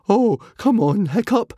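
high, loud irritated whine; irritable. A narrator's reading. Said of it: "said Fishlegs irritably".

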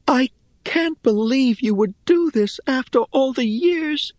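high-pitched, hurt disbelief; Rhea, reproachful. A narrator's reading. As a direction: reproach an old friend in a high voice. Rhea reproaches her old friend.

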